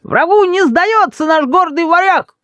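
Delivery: very high, slow declaiming; high-pitched, drawn-out battle cry; defiant child. A narrator's reading. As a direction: proclaim heroic defiance as a little boy. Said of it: "доносится глухо из-за бабушки".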